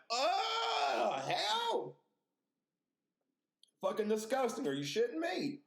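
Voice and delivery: very high-pitched, drawn-out mock horror; horrified outburst. Viewer reacting to talking movie spiders, horrified high squeal. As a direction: wail it in exaggerated disgust.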